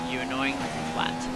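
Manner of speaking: muttered insult at Jeff; low muttered insult. Real, not acted. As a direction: grumble it under your breath. Insulting AI driver.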